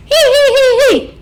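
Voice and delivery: high squeal of a laugh shown as another way; demo high laugh. Shown as a demonstration. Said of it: "laugh like this".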